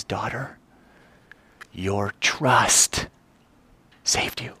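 soft, quiet smiling blessing; tender, smiling. Acted. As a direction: say it gently, beaming at her. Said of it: "With a smile this big from ear to ear".

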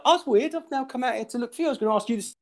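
very high, hurried fake innocence; mock innocent excuse. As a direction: pipe up with a badly faked excuse. Imagines a guilty excuse to neighbour.

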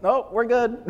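high, squeaky mock decline; hammed-up high-pitched no thanks; mock refusal. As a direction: play yourself backing out nervously. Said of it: "And it's like".

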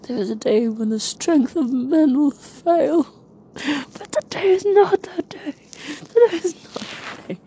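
rally the troops like a king; epic movie speech. Retold or imitated. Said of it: "I said the quote".

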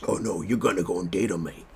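second go at a badly faked Australian accent; hammed-up, unconvincing Australian imitation; terrible Australian accent. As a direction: try harder at Aussie, still botching it. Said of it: "Is that, that sounds terrible".

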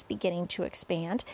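ordinary delivery

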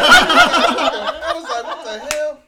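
high, loud laughing burst; real laughter. Real, not acted. Father laughing at son saying he eats pencils. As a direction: break up laughing, then gasp the words.

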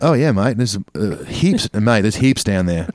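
put-on jokey Aussie accent, chuckling mid-line; Australian accent. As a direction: slip into casual Australian banter. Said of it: "the Australian one".

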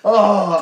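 loud, high "oh" of revulsion; disgusted groan. Real, not acted. Co-host hearing poo got in both eyes, loud disgusted groan.

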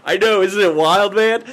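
loud, hammed-up quote; Bodhi. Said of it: "I just love Bodhi's".

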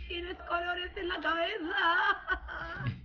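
talking through laughter; cracking up mid-sentence; laughing. Real, not acted. Laughs at the mishap. (riéndose a carcajadas)

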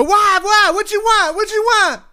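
very high-pitched imitation yell back; mock irritated woman. Woman answers the man yelling from the street, high mock irritated shout. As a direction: screech back like a fed-up partner.

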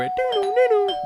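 high sing-song doorbell chime; very high-pitched bell notes; imitated doorbell. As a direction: mimic a doorbell ringing. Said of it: "Ring the door".

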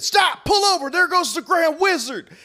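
high, loud yelling at the driver; retold panicked shout. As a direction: shriek it like you've spotted an enemy. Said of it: "you said".

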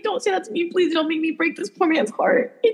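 high, soft hammed-up plea; mock pleading. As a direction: plead in a small high voice, half laughing. Retelling her dread at his question.

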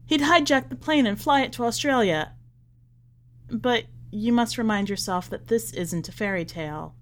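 plain reading voice

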